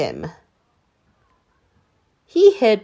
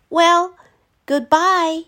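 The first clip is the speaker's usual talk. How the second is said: put-on high boy voice, louder; sad Danny goodbye; 9 semitones higher, 6 dB louder, slower than usual. A narrator's reading. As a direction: say goodbye bravely. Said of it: "said Danny".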